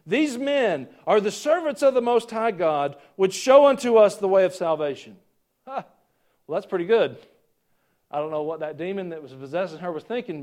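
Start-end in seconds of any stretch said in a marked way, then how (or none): 0.0-5.2 crying out. Acted. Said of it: "she's crying out".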